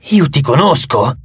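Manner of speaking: slow, straining to remember; insist, slowly searching your memory; insistent. A narrator's reading. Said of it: "insistette Teseo, sforzandosi di ricordare". (insistente, lento, cercando di ricordare)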